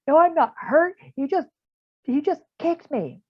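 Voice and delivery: put-on high, whiny kid protesting; upset kid voice. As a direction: squeak it out like an upset child.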